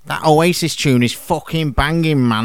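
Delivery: lad's Manc greeting; Manc accent. Shown as a demonstration. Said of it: "listen to their equivalents in Mank dialect".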